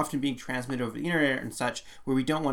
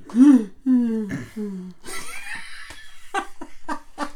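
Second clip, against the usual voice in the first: laugh; 11 semitones higher, 5 dB louder than usual. Real, not acted. Host jokes about yawning.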